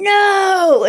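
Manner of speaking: high, loud mock scream of no; mom screaming. Mom on the phone forbidding the ride, shrieking. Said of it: "my mom's just, like, screaming".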